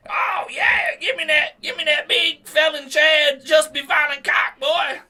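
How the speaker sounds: shrieking high falsetto granny yelling; mock horny grandma. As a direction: squeal it out like a lusty old lady. Mocking grandma voice, shouted.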